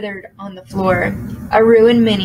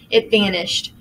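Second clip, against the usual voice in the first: ordinary delivery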